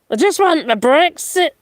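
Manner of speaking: high, whiny mock of a posh politician; mocking Rees-Mogg. Mocking a posh MP's whine about Brexit. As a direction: pout it out like a petulant toff. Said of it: "argue his Brexit".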